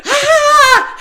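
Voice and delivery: loud, high mock scream; imitated scream. Mother imitating son with a cut finger, screaming. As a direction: let out a big hammed-up yell. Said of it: "but he screamed like".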